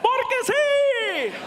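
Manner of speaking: high, drawn-out announcer cry; over-the-top DJ answer, held long; radio host voice. Acted. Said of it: "why are you talking like that".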